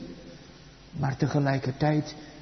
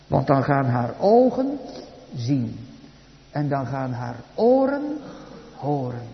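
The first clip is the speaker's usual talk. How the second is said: ordinary talk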